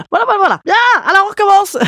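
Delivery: high, fast and loud; bright restart. Real, not acted. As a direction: jump back in brightly. Resuming after child interruption.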